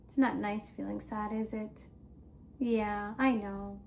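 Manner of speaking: soft, low, subdued commiseration; gentle sad sympathy. Shown as a demonstration. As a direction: console small children quietly, play-sad. Commiserating about feeling sad.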